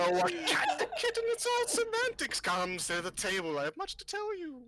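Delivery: put-on high-pitched, lilting old lady; amused old fortune-teller. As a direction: airily dismiss it, then beckon them over.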